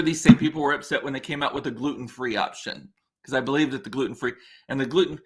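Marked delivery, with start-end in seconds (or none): none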